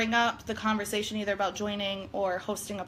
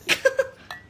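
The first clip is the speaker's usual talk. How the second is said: giggly high laugh; high burst of laughter; 13 semitones higher, 5 dB louder than usual. Real, not acted. Laughs after toasting wine.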